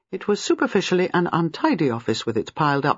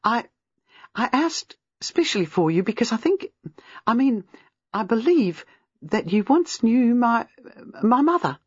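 higher, halting, rushed nervous words; rehearsed little rush; 7 semitones higher than usual. A narrator's reading. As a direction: blurt it out nervously, a touch hesitant. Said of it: "with exactly the little rush she had rehearsed".

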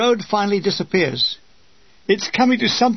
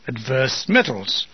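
slow, low recital; drawn-out, low intoning; intoned; 7 semitones lower, slower than usual. A narrator's reading. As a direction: savour the phrase slowly. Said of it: "I intoned repeatedly".

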